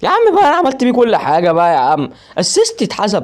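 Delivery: high, loud whine of protest; frustrated shout. Real, not acted. Gamer protesting that his assist counted, frustrated shout. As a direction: complain loudly, outraged.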